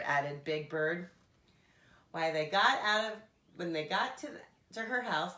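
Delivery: ordinary delivery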